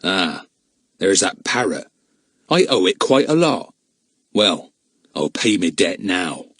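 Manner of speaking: lightly voiced rough crook; grim villain, menacing. A narrator's reading.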